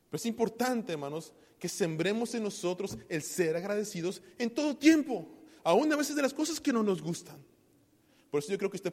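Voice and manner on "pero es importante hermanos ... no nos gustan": higher and much louder, building; preaching crescendo. Acted. Preacher building to a loud, high climax on gratitude. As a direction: climb into full-force preaching.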